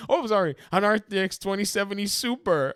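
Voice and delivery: mock afterthought. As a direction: ham up a sheepish correction.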